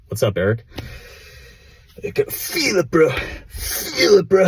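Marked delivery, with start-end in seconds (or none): 2.0-4.5 high, drawn-out falsetto imitation; mock catchphrase voice. Vlogger imitating his mentor's catchphrase for fellow viewers, high mock voice. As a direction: stretch "feel" up high like a parody.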